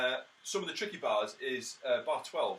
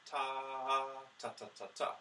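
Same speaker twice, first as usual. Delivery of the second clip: long held ta, then slower separate tas; measured, evenly tongued tas; slowed-down tonguing; 2 semitones higher, faster than usual. Shown as a demonstration. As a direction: show the rhythm at an easier speed. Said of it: "you can slow it down".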